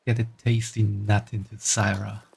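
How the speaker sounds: soft, hushed mummy voice; lightly voiced doting mummy. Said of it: "Can you even call this a mummy voice".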